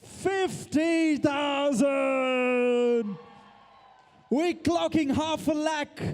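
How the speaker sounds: slow, high call of the bid; excited auction call. Real, not acted. Auctioneer announces half a lakh in a high, excited shout. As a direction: sell the milestone to the crowd.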